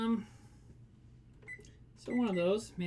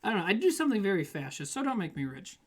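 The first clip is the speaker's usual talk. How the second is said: ordinary talk, nothing marked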